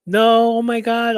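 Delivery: high, loud panicked squeal; mock scared. Vlogger mimicking a wrestler's scared cry. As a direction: squeal it like she's terrified. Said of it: "all scared and stuff".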